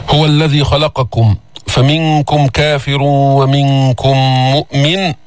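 slow measured tajweed recitation; Quran recitation. Shown as a demonstration. Teacher repeats the verse for students, slow recitation. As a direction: chant it slowly and evenly.

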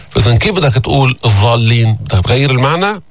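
normal talking voice

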